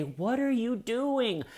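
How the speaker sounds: high-pitched, incredulous scolding; exasperated PR manager. Retold or imitated. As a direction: chew out a star client in disbelief. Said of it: "I always picture their P R manager".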